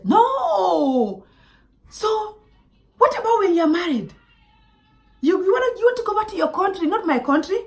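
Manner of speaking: high, loud hammed-up argument; mock angry date. Imitating her date insisting on his own country, mock angry. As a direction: throw his protest back in his face.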